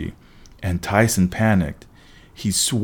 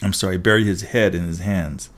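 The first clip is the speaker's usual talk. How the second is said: ordinary delivery, nothing marked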